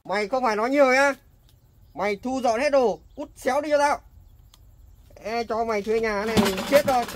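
loud, angry order to clear out; angry landlord. Acted. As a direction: bark her out of the house. Landlord throws the tenant out.

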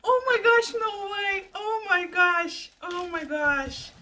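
high, loud, thrilled gasping; excited squeal. Real, not acted. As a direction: squeal with real delight at the surprise. Opening a surprise gift package.

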